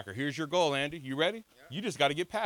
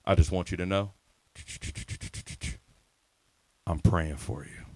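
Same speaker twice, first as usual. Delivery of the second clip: 1 smooth DM voice; 9 semitones lower, 3 dB quieter than usual. Retold or imitated. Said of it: "here's what I said".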